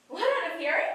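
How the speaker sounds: ordinary delivery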